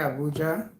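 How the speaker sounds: ordinary talk, nothing marked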